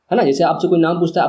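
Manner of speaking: ordinary talk, nothing marked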